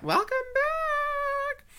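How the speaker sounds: very high, slow falsetto; high welcome. Pretend. Drag queen welcoming students back. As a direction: croon it out sweetly.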